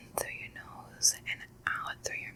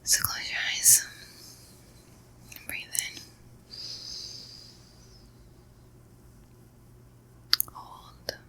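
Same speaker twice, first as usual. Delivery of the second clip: guided breathing; 3 semitones lower, 4 dB quieter than usual. Acted. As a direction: coax them through each breath, unhurried.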